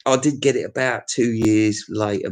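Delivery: ordinary talk, nothing marked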